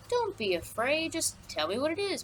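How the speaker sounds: lightly acted, coaxing it out of him. Creon urges the frightened guard to talk, mildly coaxing.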